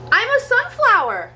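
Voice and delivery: high, loud cry of delight; squeaky excited child shout. Acted. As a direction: burst out with joy like a little child. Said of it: "cried Scurry".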